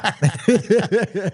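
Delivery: loud burst of laughter. Real, not acted. Host laughing at guest's chaos remark, amused laugh.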